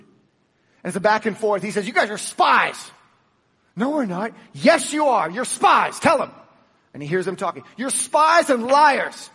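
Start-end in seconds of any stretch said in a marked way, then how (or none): 1.9-6.3 loud, high accusation of the brothers; accusing. Acted. Joseph to his brothers, loud accusing voice. 7.8-9.3 high, loud and slow accusation; accusing. Acted. Joseph to his brothers, slow loud accusation.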